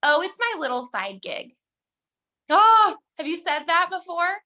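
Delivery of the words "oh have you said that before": high, loud teasing gasp of surprise; playful high-pitched surprise; mock surprise. Real, not acted. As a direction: catch them out with a playful shock. Teasing the audience.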